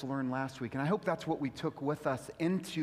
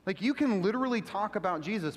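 usual speaking voice

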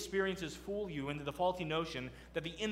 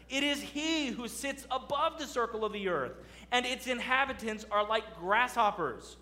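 raised, emphatic preaching read; scripture reading; 3 semitones higher, 3 dB louder than usual. Acted. Preacher reading Isaiah to the congregation, emphatic.

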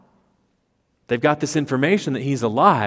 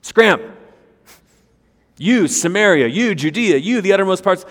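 loud, high hurry-up orders; loud, high angels dispatching the disciples; brisk angel command; 6 semitones higher, 7 dB louder than usual. Pretend. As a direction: shoo them off like a coach sending players out. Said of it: "they need the angels to say".

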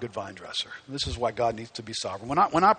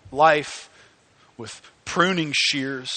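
ordinary delivery

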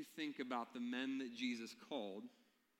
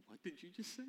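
mock baffled, higher pitch; surprised; 7 semitones higher, faster than usual. Pastor reacting to the translator's odd remark, stunned and higher. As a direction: replay your own startled "what did you just say". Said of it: "And I was like".

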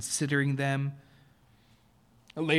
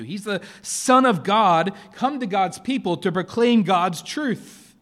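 loud, slow, emphatic proclamation; forceful preaching; 7 semitones higher, 9 dB louder than usual. Real, not acted. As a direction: drive each word home to the church.

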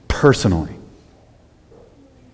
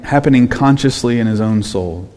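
usual speaking voice

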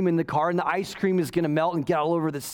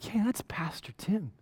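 soft whispered aside, pointing him out; a churchgoer whispers, recognizing the pastor; gossiping whisper; 8 dB quieter than usual. Pretend. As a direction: whisper it like a shocked onlooker. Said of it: "she could have been like".

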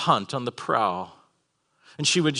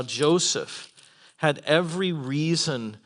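ordinary talk

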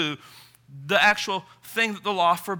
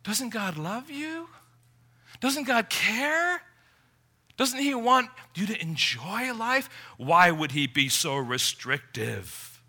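higher, slow, questioning tempter; a higher, slowed, insinuating voice of temptation; voice of sin; 4 semitones higher than usual. Retold or imitated. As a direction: coax like a sly tempter whispering doubts. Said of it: "sin seizes on that thought and says".

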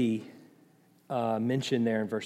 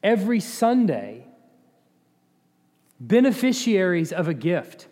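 higher, louder, drawn-out preaching; emphatic preaching; 7 semitones higher, 7 dB louder than usual. Real, not acted. Preacher telling the congregation why they worship, emphatic and loud. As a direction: preach it out forcefully, word by weighty word.

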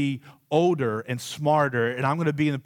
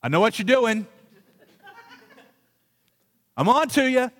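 raised, high-pitched kid's confrontation, acted out; getting louder; 8 semitones higher, 4 dB louder than usual. Child in the bathroom confronting the hidden microphone, getting louder. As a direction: act out calling their bluff, loud and high. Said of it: "I get louder and louder and louder".

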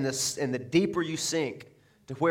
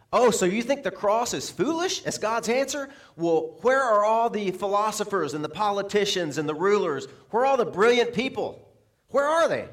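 higher-pitched, incredulous mock questioning; acted rhetorical challenge; 5 semitones higher than usual. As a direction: challenge them as Paul, pitch climbing with each question. Paul challenging the so-called wise, acted.

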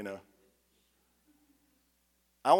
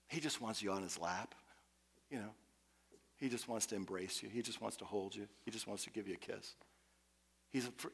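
ordinary delivery

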